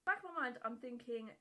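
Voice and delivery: ordinary talk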